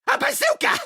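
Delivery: high, delighted squeal; thrilled repeat. Acted. Rabbit gloating to Sam about being a bazooka. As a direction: squeal the word with glee.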